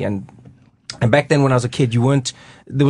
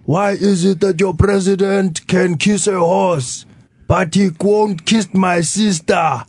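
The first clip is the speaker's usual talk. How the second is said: higher, slow, mock-innocent question; grandfather's punchline; 7 semitones higher, much slower than usual. Grandfather asking the policeman, slow, high mock innocence. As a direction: ask it slowly and sweetly, pitched up. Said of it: "and he says".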